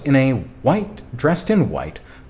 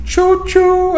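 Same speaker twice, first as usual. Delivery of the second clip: high, loud squeaky choo-choo like a toy train; train whistle choo-choo; 7 dB louder than usual. Pretend. Greeting the hype train with a shrill choo-choo. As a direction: toot it out like a little train whistle. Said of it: "Let me give that little choo-choo".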